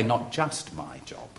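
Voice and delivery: softened correction. Shown as a demonstration. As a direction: take it back quietly and mildly. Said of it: "Or shall I say".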